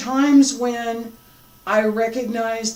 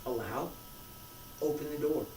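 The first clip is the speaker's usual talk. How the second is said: guided meditation; 17 dB quieter than usual. Acted. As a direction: ease them into each breath, softly. Leads a guided breathing exercise.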